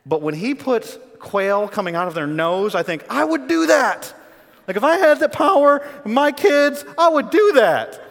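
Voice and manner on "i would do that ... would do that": high, loud, drawn-out exclamation; comic outburst, pitched way up; gleeful admission. Retold or imitated. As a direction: belt it out slowly with comic relish. Gleefully admits he would do it.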